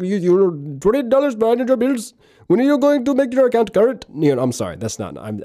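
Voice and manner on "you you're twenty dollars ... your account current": high-pitched mock foreign accent of a bill collector; hammed-up accent, chasing a debtor; [name]'s put-on accent. Bill collector calling day and night about overdue bills, comic put-on accent. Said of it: "I just wanted to do that accent".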